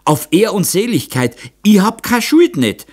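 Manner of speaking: high, loud protest in dialect; agitated old man swearing innocence; gasping old man. A narrator's reading. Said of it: "keuchte der Alte". (aufgeregter Alter, hoch und laut im Dialekt)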